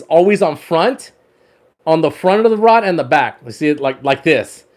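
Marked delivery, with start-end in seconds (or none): none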